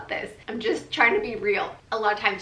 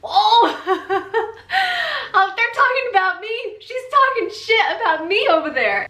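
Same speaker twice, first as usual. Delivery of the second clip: high, loud, laughing through the words; laughing disbelief; 12 semitones higher, 9 dB louder than usual. Retold or imitated. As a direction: crack up at being gossiped about. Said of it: "And I was just like".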